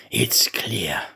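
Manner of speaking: hushed, secretive whisper; whispered aside. A narrator's reading. Servant whispering his clever guess to himself. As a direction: breathe it to yourself like a secret.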